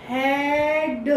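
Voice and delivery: हेड drawn out slowly with a short e; short e, slowed. Shown as a demonstration. Teacher slowing head to show the e. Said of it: "ए की sound आ रही है".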